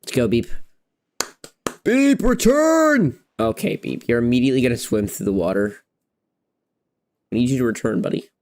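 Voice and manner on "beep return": high, drawn-out loud command; calling out. Real, not acted. Player ordering follower Beep back, calling out high. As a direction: holler it long and high to summon someone.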